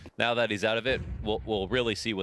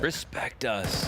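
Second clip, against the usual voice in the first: mock team voice; 5 semitones higher, much slower than usual.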